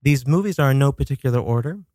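ordinary talk

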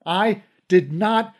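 slow, high, loud denial; slowed, high desperate scream. Acted. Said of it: "I screamed".

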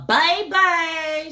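put-on baby punched high and loud, drawn out; baby with a loud, high pop; popped BABY. Shown as a demonstration. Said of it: "put a little p-, pop on mine".

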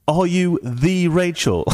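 slow, high-pitched imitated wonder; starstruck awe. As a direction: draw it out as an awed fan. Starstruck friend asks the celebrity.

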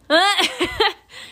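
a short high burst of real laughter; sudden laugh. Real, not acted. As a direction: break into a quick real laugh. Bursts out laughing mid-advice.

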